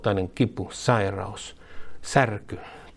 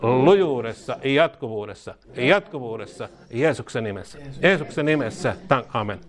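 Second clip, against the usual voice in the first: leading a prayer; 6 semitones higher than usual. Acted. Preacher leading the church in a repeated prayer, fervent call. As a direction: call each line out to be repeated.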